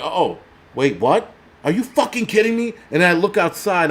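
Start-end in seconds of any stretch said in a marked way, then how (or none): 0.0-2.7 rising to a high indignant squeak; mock outrage, pitched high at the end; mock disbelief. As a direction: act baffled, then outraged. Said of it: "I'm like".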